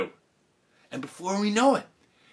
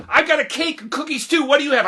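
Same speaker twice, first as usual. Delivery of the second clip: high, loud, eager boasting; excited child; 13 semitones higher, 7 dB louder, faster than usual. Retold or imitated. Girl on the bus showing off party treats, excited. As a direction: show off eagerly, voice pitched way up.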